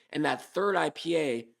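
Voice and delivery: usual speaking voice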